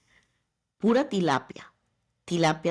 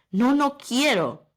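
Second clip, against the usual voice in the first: slow, high, louder mock whine; whiny refusal; 6 semitones higher, 5 dB louder, slower than usual. Refusing her husband's order to train, slow whiny no. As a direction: drag out a childish no, no quiero. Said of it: "Y vino mi esposo y me dijo".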